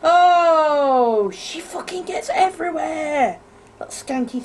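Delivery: panicking gamer. Real, not acted. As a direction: yelp it as she chases you. Chased by monster in game.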